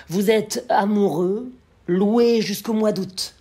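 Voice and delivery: loud, high declamation; poem recitation. Acted. Lecturer declaims the lover's final verses, ringing poem recitation. Recites the poem's last chapter.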